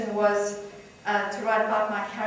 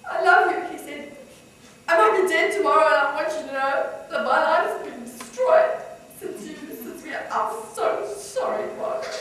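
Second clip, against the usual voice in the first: put-on high, maudlin drunken confession; acted drunk, pouring out remorse; 6 semitones higher, 5 dB louder than usual. A narrator's reading. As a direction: plead tipsily, voice lifting into apology. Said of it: "He was drunk".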